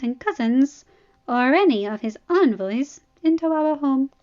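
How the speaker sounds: proud princess. Acted. Arianne voiced by narrator.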